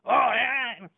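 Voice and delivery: big high, loud holler; mock battle-ready bellow; retold combative shout. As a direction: yell it as a challenge. Re-enacting the shouted face-off.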